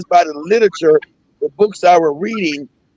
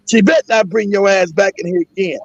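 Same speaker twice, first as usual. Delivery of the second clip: ordinary talk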